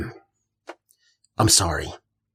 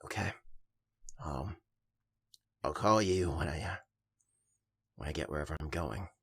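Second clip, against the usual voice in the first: much softer, low and hesitant; hushed, hurt goodbye; 6 semitones lower, 15 dB quieter than usual. Acted. Rejected friend saying goodbye to Benny, soft and hurt. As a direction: barely get the words out, wounded.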